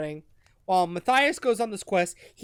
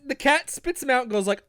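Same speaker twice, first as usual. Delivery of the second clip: usual speaking voice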